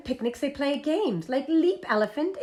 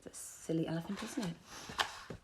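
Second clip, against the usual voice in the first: soft whispered comment; whispered aside; 6 semitones lower, 15 dB quieter than usual. Acted. Teacher whispering a playful aside to the children. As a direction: lean in and whisper it.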